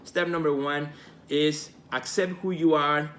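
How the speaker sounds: ordinary delivery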